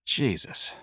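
whispered groan of disgust; exasperated whisper. Acted. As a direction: breathe it in disgust. Exasperated under breath.

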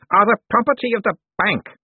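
high-pitched, insistent claim; agitated declaration. A narrator's reading. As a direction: declare it with wavering fury. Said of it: "he declared".

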